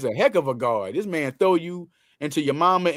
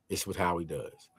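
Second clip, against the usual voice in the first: softer repeat, almost to himself; quiet echo; 10 semitones lower, 11 dB quieter than usual. Shown as a demonstration. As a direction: drop it down as a quiet afterthought.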